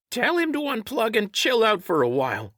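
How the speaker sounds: very high little creature voice; high-pitched whiny sidekick; bleating creature. A narrator's reading. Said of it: "Wish bleated".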